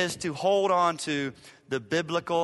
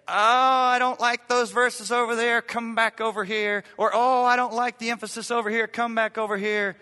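higher, louder mocking voice of a picky reader; mock whiny complaint; 6 semitones higher, 5 dB louder than usual. Pastor mocking believers who dodge verses, higher and louder. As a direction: parody someone waving verses away, hammed-up.

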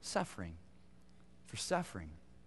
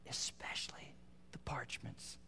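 much softer whispered "especially the parchments"; 2 semitones lower, 15 dB quieter than usual. Retold or imitated. Apostle's final request, dropped to a whisper. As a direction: breathe the last words. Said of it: "It's almost like".

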